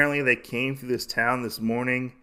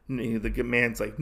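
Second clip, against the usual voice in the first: ordinary delivery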